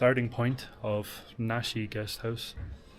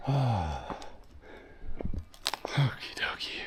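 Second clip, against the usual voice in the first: whispering; 6 dB quieter, slower than usual. Real, not acted. Settling in after climb.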